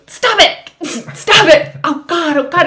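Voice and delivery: high, loud stop it; panicked protest. Retold or imitated. Begging partner to stop the soundtrack, shrieking. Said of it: "It was awful".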